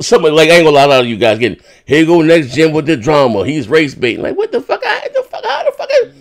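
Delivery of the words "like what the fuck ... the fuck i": high squeaky laughing disbelief, softer; squealing laugh. Real, not acted.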